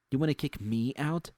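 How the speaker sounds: WANNA hit hard, line ends on a sharp upward question; rising angry challenge. A narrator's reading. Narrator repeating his dare to boss Susie, heated and rising.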